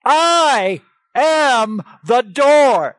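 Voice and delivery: loud, high, drawn-out "I", "am" and "door"; ringing, stretched-out emphasis on "I am the door"; emphatic declaration. Retold or imitated. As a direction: proclaim "I am the door" very slowly and forcefully, word by word. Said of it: "And I put that emphatic".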